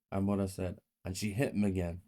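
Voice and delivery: ordinary delivery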